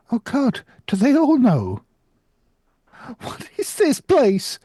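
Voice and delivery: rising terrified cry. Acted. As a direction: let panic climb. Simon terrified, louder and higher.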